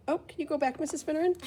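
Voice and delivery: ordinary delivery, nothing marked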